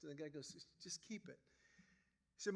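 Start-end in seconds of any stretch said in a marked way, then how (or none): 0.8-1.4 softly, casual shrug of a line; quiet offhand. Retold or imitated. Catcher casually giving glove away.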